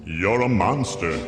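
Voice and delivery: ordinary delivery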